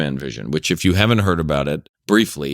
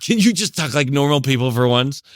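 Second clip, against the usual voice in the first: high-pitched exasperated mom, mildly imitated; a light mock of a fed-up mother; annoyed mom; 8 semitones higher than usual. Son mimics his irritated mom scolding the accent game, mock exasperated. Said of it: "my mom would be pissed".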